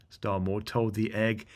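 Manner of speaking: ordinary delivery, nothing marked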